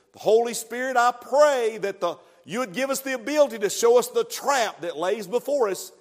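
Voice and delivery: earnest pulpit prayer rising in intensity; opening prayer. Acted. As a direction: pray it over the congregation with growing fervour. Pastor leads opening prayer.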